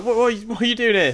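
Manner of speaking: high, loud and fast panicked questions; flustered by someone popping in uninvited; alarmed what are you doing here. Retold or imitated. Imagining an intruder in her head, high and panicked. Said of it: "I'd be like".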